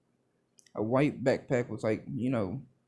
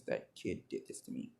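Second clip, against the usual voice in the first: put-on much lower, breathy old-lady grumble; low hoarse grumble; 12 semitones lower, 12 dB quieter than usual. Recovery Girl blaming Deku, in a low breathy grumble. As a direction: rasp it out under your breath like a cranky old nurse. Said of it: "Recovery Girl, you know, says".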